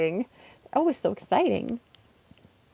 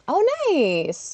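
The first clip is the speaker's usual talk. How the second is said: ordinary delivery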